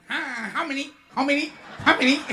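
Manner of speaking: mock Chinatown waiter rushing customers; brusque Chinatown waiter. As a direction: bark it like an impatient waiter. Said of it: "kalau kita datang ke Chinatown".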